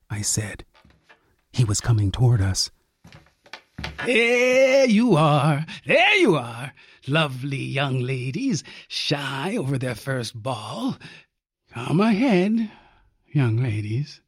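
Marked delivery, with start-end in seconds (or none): 4.0-14.2 lightly voiced old man, slow and coaxing; teasing old man. A narrator's reading. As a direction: coax them out like a creepy old host. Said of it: "shrill, cracked voice".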